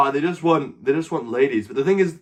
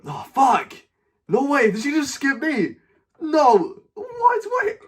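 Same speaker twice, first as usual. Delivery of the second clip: high, disbelieving yelps; can't believe she skipped him; shocked outcry; 11 semitones higher, slower than usual. Real, not acted. Streamer yelping in disbelief when a stranger skips him.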